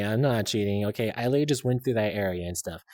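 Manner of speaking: ordinary talk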